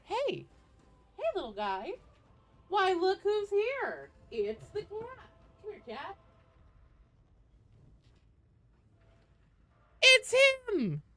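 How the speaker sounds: high-pitched cooing at a cat; talking to a pet. Real, not acted. Streamer spotting the little cat wandering in, pet-talk voice.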